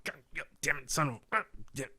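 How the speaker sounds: soft, grumbling "God damn it" under the breath; muttered cursing. Retold or imitated. Intern alone cleaning up, muttering curses in irritation. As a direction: swear quietly to yourself, fed up. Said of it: "pissed off".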